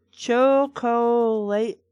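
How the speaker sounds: "chocolate" very slow, syllable by syllable; broken into syllables. Shown as a demonstration. Reader showing the badly broken-up word, "chocolate" in slow separate syllables. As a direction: stretch out each syllable of "chocolate". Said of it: "shouldn't have been broken up like that".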